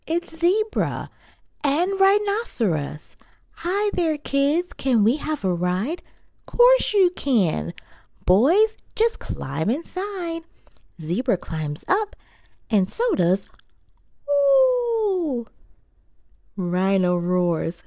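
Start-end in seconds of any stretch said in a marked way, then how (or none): 0.1-10.4 high animal voices. Acted. Zebra and rhino characters. 14.3-15.5 high surprised ooh. Acted. High exclaim.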